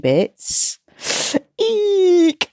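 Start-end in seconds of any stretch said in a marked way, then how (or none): 1.6-2.5 high nervous eek; nervous squeal. Real, not acted. Nervous host squeals about recording without stopping, high squeak. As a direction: squeak with nerves. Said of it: "I'm a tad nervous".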